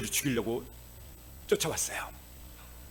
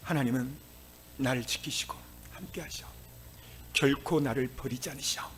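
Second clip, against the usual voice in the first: whispered declaration; 3 semitones lower, 8 dB quieter than usual. Shown as a demonstration. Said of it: "선포하셔야 돼요".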